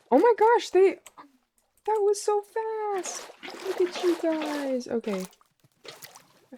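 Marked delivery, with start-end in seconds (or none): 0.1-4.9 high, delighted gasping squeal; thrilled, voice jumping up high; excited squeal. Real, not acted. Baby frogs hatch fast.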